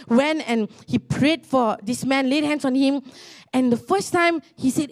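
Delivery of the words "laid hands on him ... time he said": normal talking voice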